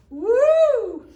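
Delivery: very loud, high "woo"; sudden acted whoop for a lurching stomach; queasy stomach whoop. A narrator's reading. Sick chief showing children what his stomach does, loud whoop. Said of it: "my stomach goes".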